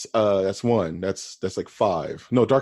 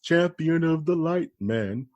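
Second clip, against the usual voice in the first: high, slow heraldic announcement; mock hero; 7 semitones higher, slower than usual. Friend riffing on a Darkman sequel, mock-heroic. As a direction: proclaim it grandly, drawn out. Joins the mock movie declaration.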